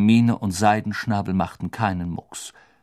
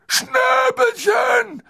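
very high, louder, slow wail; tearful cry; 7 dB louder, much slower than usual. Acted. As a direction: cry out the name, overjoyed. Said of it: "rief Hagrid".

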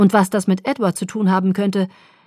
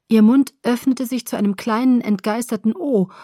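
ordinary delivery

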